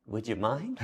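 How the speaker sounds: soft, polite little ask; mock-sheepish quiet request; gentle request. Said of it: "I just touched his leg gently".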